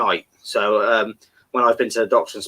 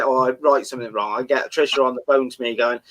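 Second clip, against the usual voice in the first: ordinary delivery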